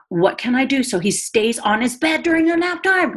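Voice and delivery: high-pitched, frantic inner voice without real volume; retold inner screaming. Mother retelling her snapping at son's naptime, mock screaming. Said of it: "screaming person in my head".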